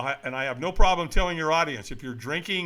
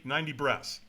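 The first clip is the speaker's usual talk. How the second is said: ordinary delivery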